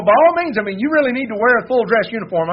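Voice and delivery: sneaky king pushing his friend to dress up; eager urging. Retold or imitated. Ahab slyly urges royal robes.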